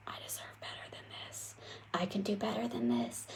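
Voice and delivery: breathless whisper fading into a soft voice; inner whisper. Retold or imitated. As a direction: whisper it like a small inner voice urging you on. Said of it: "that little whisper".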